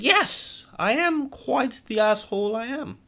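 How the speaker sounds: high, haughty put-on English accent; demon's mock English accent. Demon admitting to his victim, in high mock English accent. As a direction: own up grandly in mock English. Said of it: "that fucking English accent".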